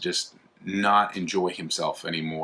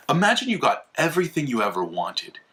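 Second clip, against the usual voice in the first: ordinary delivery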